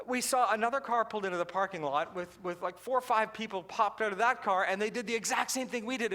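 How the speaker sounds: usual speaking voice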